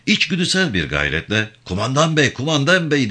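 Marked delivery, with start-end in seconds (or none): 1.7-2.3 first call, higher and pleading. A narrator's reading. Yavan calling after the squad, first higher call. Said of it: "diye bağırdı". 2.3-3.1 second call, pitched even higher; calling out louder. A narrator's reading. Yavan calling again to the leaving sergeant, higher still. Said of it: "diye bağırdı".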